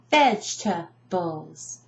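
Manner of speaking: "Vegetables" slowly as vej, tuh, bulls; "Vegetables" chopped into three beats, no middle E; broken into syllables. Shown as a demonstration. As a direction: say "Vegetables" in three separated chunks. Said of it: "I break it down completely".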